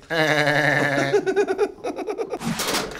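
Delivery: long rattling vocal machine-gun burst; mouth-made Tommy gun fire; imitated Tommy gun. Shown as a demonstration. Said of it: "Vince Vaughn Tommy gun sounds like".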